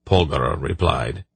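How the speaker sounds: plain reading voice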